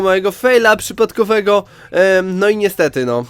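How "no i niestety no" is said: ordinary talk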